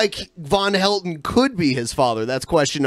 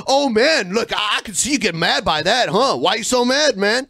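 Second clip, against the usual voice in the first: imitated troll taunt, pitched up; mocking internet troll; 5 semitones higher than usual. Host parodies a troll who sees someone got mad, mocking taunt. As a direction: goad them in a higher mock voice. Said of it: "That's the other one".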